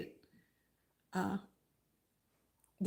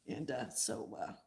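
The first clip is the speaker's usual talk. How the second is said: normal talking voice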